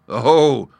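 loud, high, scoffing agreement; laughing confidence. Acted. Lion expecting an easy victory, amused and loud. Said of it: "laughed the lion".

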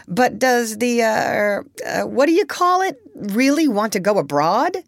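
high, groping for the word; lightly voiced puzzled doctor, stumbling over the name; hesitant doctor. A narrator's reading. Doctor gropes for the animal's name.

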